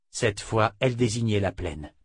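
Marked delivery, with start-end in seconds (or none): none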